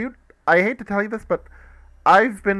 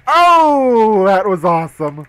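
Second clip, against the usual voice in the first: excited; 4 semitones higher, 11 dB louder, slower than usual. Real, not acted. Thrilled after the play.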